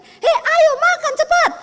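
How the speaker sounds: high, loud, drawn-out call; shouting call. Retold or imitated. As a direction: holler at him to hurry and eat. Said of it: "saya teriak gini".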